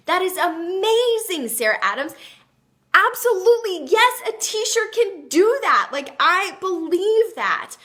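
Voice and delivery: high, slow and loud, gushing with delight; excited praise. Real, not acted. Host cheering a viewer's t-shirt dream, delighted and loud. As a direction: cheer her on like her biggest fan.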